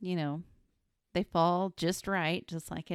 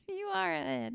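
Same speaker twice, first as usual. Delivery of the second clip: mock squeaky echo; 12 semitones higher than usual. Co-host echoing, mocking.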